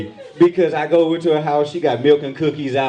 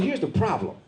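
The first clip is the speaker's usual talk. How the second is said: protective father rant; 8 semitones higher than usual. Retold or imitated. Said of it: "the father in me jumped out".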